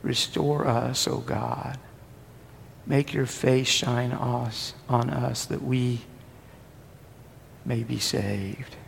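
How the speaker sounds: slow, soft prayer; prayerful reading. Acted. Preacher reading the psalm to the congregation, slow soft prayer. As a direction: pray it slowly and gently.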